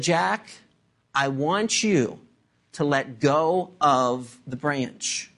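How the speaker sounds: low, slow, measured command from above; voice of God. Acted. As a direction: speak as God, calmly and deliberately. Acting God's calm instruction to Jack.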